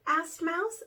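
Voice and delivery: ordinary delivery, nothing marked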